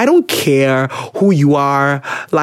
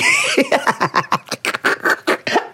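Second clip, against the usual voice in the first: helpless laugh at own joke; breathless real laughing fit; 9 semitones higher, 4 dB quieter than usual. Real, not acted. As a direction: laugh until it turns to wheezing. Cracks up at own impression.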